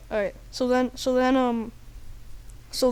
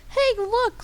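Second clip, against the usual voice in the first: high, silly heckler; high-pitched obnoxious joker; annoying guy; 10 semitones higher than usual. Acted. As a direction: call out in a high dopey voice. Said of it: "annoying guy says".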